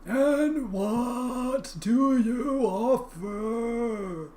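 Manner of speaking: drawn-out high river spirit; slow booming river voice. Acted. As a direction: speak as an ancient river, stretched slow.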